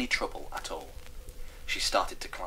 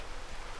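sharp shocked intake of breath; affronted gasp; offended gasp; 9 dB quieter than usual. Acted. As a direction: gasp in outrage. Hamish offended by the verdict.

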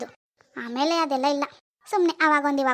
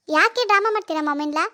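ordinary talk, nothing marked

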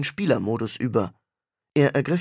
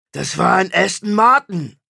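higher, louder, offended star voice; indignant rock star; 6 semitones higher, 6 dB louder than usual. Acted. Rock star brushing off his brother's car advice, indignant. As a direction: bristle like an insulted celebrity.

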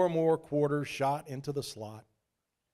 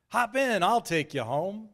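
Shane's voice; 6 semitones higher, 3 dB louder than usual. A narrator's reading.

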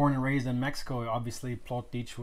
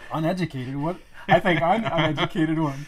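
louder, incredulous self-mocking outburst; mock disbelief; 4 semitones higher, 10 dB louder than usual. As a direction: act stunned, then laugh at yourself. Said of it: "and I'm like".

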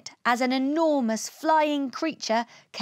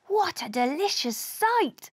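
higher, eager exclamation; mildly excited, raised pitch; delighted cry; 6 semitones higher than usual. A narrator's reading. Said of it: "cried Ugg".

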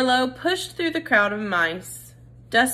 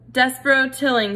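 a touch louder formal address; lightly voiced stern judge; 5 semitones higher, 5 dB louder than usual. A narrator's reading. Head mouse summons Despero, mildly stern formal call. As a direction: call the accused forward.

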